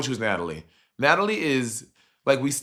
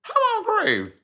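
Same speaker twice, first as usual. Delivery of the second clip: a far higher, squeaked mock-baffled question; squeaky bafflement. Host confused by the sponsor name, squeaked mock disbelief.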